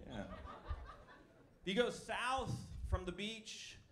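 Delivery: ordinary talk, nothing marked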